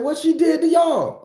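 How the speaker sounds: very high, loud demand; outraged yell. Retold or imitated. Grandson demanding answers from the girl, very high and loud. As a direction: push it up high in disbelief.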